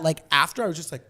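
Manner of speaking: usual speaking voice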